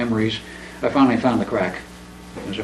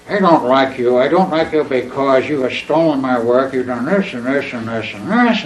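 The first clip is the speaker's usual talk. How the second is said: put-on louder, accusing drunk on the phone; drunken voice; 3 semitones higher, 6 dB louder than usual. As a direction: rant like a drunk blaming someone. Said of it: "in a very drunken tone of voice".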